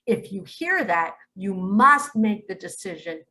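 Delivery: ordinary talk, nothing marked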